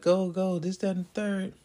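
high mock cheering from the bench; retold bench yelling. Bench players cheering on teammates, high mock yell. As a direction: lightly voice the bench shouting go. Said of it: "just kinda yelling".

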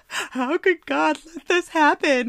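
exasperated mimicry; mock quote. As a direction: parrot it with exasperation. Mocking a phrase she hates.

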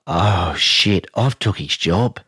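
low, slow oh-no dread; a young coach sure he's in trouble, mock dread; panicked thought. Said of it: "I'm like".

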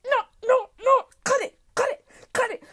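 high, urgent staccato; frantic stop warning. Acted. March telling July to cut it, frantic warning. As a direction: call it off in high bursts.

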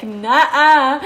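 mock refusal in a put-on voice; flat dismissive nah. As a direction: wave him off with one word. Reacting to a junkie sitting down.

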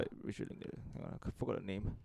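unvoiced whisper to herself. Real, not acted. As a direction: whisper while searching. Muttering while searching phone.

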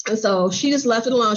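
normal talking voice

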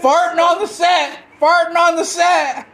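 shouting into the mic, distorted; yelling so loud the mic clips; clipping distorted mic. Real, not acted. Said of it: "Why are you yelling into the mic".

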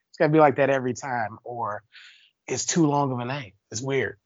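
ordinary talk

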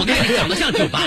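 said through a laugh, high. Pretend. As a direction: laugh out the pun. Repeated pun retort, laughing.

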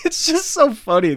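high-pitched, giggly amusement; laughing. Real, not acted. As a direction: crack up while saying it. Cracks up at own story.

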